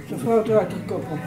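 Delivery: plain reading voice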